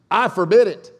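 very loud, high decree; commanding. Acted. Peter trying out his new keys, loudly commanding. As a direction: boom out the order.

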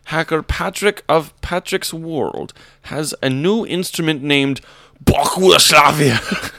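hammy German reading, building to a loud finish; over-the-top German accent. Acted. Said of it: "but with an accent".

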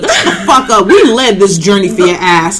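loud, laughing mock fury; laughing outrage. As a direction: yell it half-laughing, defending your country. Defending Haiti, mock outraged.

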